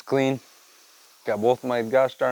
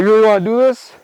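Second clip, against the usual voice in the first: higher and louder, squaring up; mock challenge; 7 semitones higher, 8 dB louder, faster than usual. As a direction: dare the rooster, hammed-up. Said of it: "I'm like".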